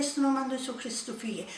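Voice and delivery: fast, breathless whisper; a hushed rebuke fading out; whispered command. Retold or imitated. Mouth repeating command on its own.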